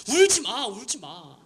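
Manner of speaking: mock shouting at crying kids; half-acted pastor telling the teens to stop crying; retold shout. Pastor yelling at crying teens not to cry, imitated. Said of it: "수련회 때 그랬대요".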